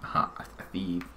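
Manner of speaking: unvoiced sly whisper. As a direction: breathe it like a sneaky villain. Whispered game reaction.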